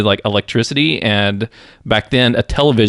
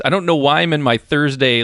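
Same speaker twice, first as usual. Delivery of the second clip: normal talking voice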